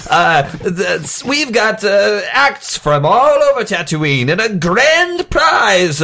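high, drawn-out crier drumming up a crowd; old-timey carnival barker. Acted. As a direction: hawk the show like an old-time barker. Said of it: "like an old-timey carnival barker".

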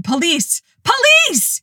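high frantic cry for police; panicked cry. A narrator's reading. Terrified caller crying to dispatcher, high panicked yell. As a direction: shriek it in panic.

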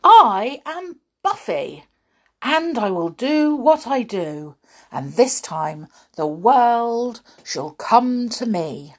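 slow, high, ringing declaration; triumphant underdog finding his resolve; proud proclamation. A narrator's reading. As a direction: announce it grandly, leaning on each key word. Dog proudly declares who he is.

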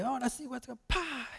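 lightly hamming up the showy pastor; mock pastor.

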